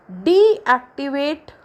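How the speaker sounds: Deactivate with डी stretched and stressed; stress on first syllable. Shown as a demonstration. Teacher shows students primary stress, stressing डी in deactivate. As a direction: lean slowly on the डी of deactivate. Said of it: "डी के ऊपर stress mark जो है वो primary".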